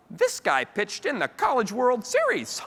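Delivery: high, loud, drawn-out astonishment; hammed-up wonder, oblivious; amazed mom. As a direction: imitate an impressed mom, slowly and way up high. Oblivious mom amazed by what she reads.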